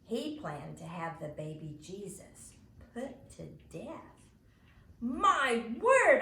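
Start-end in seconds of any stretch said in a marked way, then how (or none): 5.0-6.2 loud, higher outraged cry; shocked outburst. Acted. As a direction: blurt it out in scandalized shock. Imogene outraged at Herod's plan.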